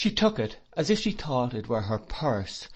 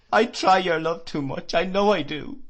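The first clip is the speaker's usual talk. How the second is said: higher, louder sobbing confession; sobbed; 7 semitones higher, 6 dB louder than usual. Acted. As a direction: sob it out. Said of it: "she sobbed".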